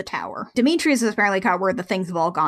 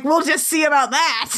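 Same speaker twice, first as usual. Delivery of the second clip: higher, put-on plucky retort; mock defiance; 6 semitones higher than usual. Host voicing Kimber answering her captor, mock defiance. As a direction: play up the defiant comeback.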